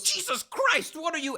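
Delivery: very high startled outburst; hammed-up high female alarm; startled woman. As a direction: recoil with a mock shriek of a question. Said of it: "She goes".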